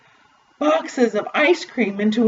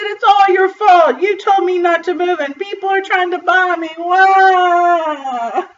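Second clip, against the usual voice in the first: put-on wailing child; 8 semitones higher than usual. A narrator's reading. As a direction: complain high, ending in a long wail. Child blames father and wails.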